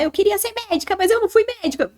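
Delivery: imitating someone. Quoting a frustrated former dreamer.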